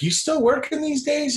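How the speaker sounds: very high, put-on phone small talk; high-pitched chit-chat. Retelling his question to the stockbroker, high put-on voice. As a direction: ask it way up high, imitating yourself.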